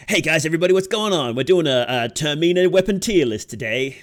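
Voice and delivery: loud, high hype-channel opener; YouTuber voice. Shown as a demonstration. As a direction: pump it up like a clickbait host. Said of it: "A good YouTuber voice?".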